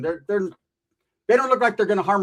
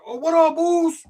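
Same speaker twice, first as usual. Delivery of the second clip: high-pitched sudden friendly squeak; startled nervous greeting; 11 semitones higher, 4 dB louder, slower than usual. Retold or imitated. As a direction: greet him high and scared. Startled greeting to big attacker.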